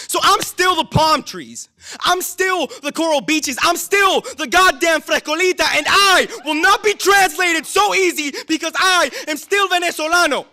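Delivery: high, loud, impassioned cry; spoken-word climax, ringing with defiance; defiant declaration. Acted. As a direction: proclaim it to the whole hall at full force. Poem's defiant declaration of identity.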